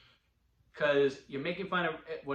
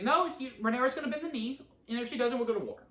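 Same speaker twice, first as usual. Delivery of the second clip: mock stern ultimatum; 9 semitones higher, faster than usual. Quoting Alicent's ultimatum.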